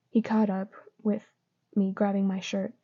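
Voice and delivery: ordinary delivery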